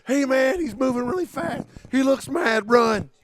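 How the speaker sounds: high, loud play-acted alarm; mock panicked warning. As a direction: yell a hammed-up warning to a teammate. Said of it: "letting him know, like".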